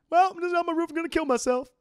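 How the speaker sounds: hammed-up Bill cartoon voice; Bill impression. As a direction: play the hapless guy on the roof. Voicing the sad neighbor Bill.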